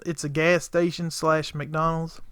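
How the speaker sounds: normal talking voice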